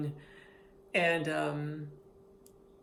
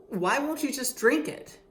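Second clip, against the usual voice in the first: higher, louder doctor; a hint of a pushy doctor, raised; retold doctor; 7 semitones higher, 5 dB louder than usual. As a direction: push the question higher. Doctor voiced in story.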